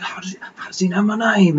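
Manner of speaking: high, hurried, whispery mock unease; mock spooked whisper. Player replays reaction to hearing the name, whispery unease. Retells reaction to creepy naming.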